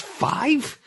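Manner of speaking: host stunned by five floods, high incredulous; astonished. Real, not acted. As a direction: ask it in sheer disbelief. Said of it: "Oh my gosh".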